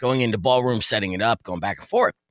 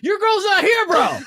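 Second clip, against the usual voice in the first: much higher, slow, shouted through laughs; laughing mock lecture; slower than usual. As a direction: scold the buddy while cracking up. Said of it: "I'm like".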